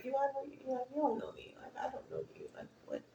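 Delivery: hushed, almost whispered thoughts; muttering it under the breath; inner voice. Retold or imitated. To herself, puzzled by a stranger's comment, hushed. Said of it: "In my mind, I'm like".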